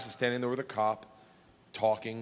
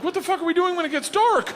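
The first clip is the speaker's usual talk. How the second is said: high, loud alarmed question; 14 semitones higher, 7 dB louder than usual. Retold or imitated. Hearing Igor's plan, a high loud alarmed question. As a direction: shout it in disbelief.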